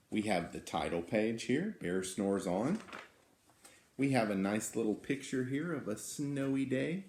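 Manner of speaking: ordinary delivery, nothing marked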